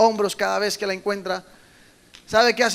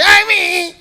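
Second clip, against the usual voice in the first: much higher, louder bleating cry; mock sheep bleat; 10 semitones higher, 9 dB louder, much slower than usual. The injured lamb cries out, hammed-up high bleat. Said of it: "la ovejita hace".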